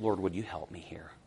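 soft unvoiced plea; whispered prayer. Believer asking God for help when frustrated, whispered.

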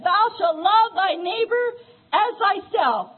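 slow, emphatic verse reading; solemn recitation of commandment; scripture reading. Acted. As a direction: lean on each word of the law.

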